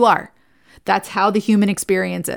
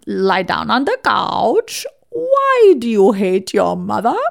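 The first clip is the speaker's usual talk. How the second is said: put-on slow, drawn-out toy Freud with a German accent; Sigmund Freud; 4 dB louder, slower than usual. Therapist imitating a childhood talking toy, mock German accent. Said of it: "in the voice of Sigmund Freud in this German accent".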